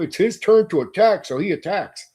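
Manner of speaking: up-and-down parody of game logic; mock rote recital. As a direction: recite it like a rule being followed mindlessly. Mocking rote game-mechanic thinking.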